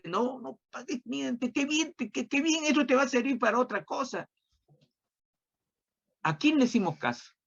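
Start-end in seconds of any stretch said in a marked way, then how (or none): 0.0-4.3 higher, coaxing devil whispering temptation; tempting devil voice. Retold or imitated. Preacher voicing Satan tempting the faithful, higher and coaxing. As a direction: sweet-talk them like a tempter. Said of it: "Satanás, que aprovecha la ocasión para decirte".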